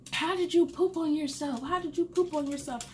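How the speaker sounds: high-pitched imitated scolding question; incredulous mother. Mother confronting her crying son, imitated high incredulity.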